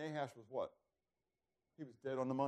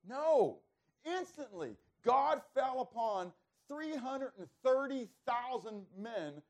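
fervent preaching; 9 semitones higher, 6 dB louder, slower than usual. Acted. Preacher builds to a climax.

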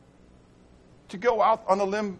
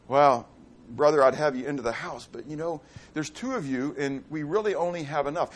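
stingy householder; 6 semitones lower than usual. Acted. Preacher voices stingy householder.

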